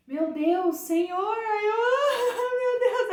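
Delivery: loud, high cry breaking into laughter; rindo e chorando. Retold or imitated. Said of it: "comecei a rir, a rir, a chorar". (clamor alto e agudo, entre riso e choro)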